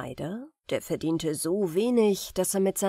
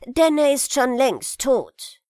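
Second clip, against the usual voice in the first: put-on high, loud cheeky boy; 5 semitones higher, 8 dB louder than usual. Aladdin tells the stranger bluntly, cheekily. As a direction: say it bluntly, unbothered. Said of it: "sagte Aladdin keck".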